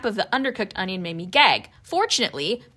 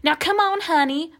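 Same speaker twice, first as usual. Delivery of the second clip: coaxing Southern grandma voice, pitched higher; Southern drawl; 7 semitones higher, 3 dB louder than usual. Retold or imitated. Grandma coaxing the boy to eat meatloaf, Southern drawl. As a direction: sweet-talk the child in a high Southern drawl. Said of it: "Mama Roo said in her southern drawl".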